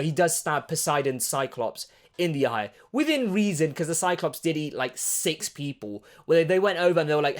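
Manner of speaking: normal talking voice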